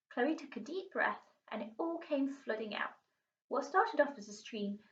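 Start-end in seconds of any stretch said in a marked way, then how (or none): none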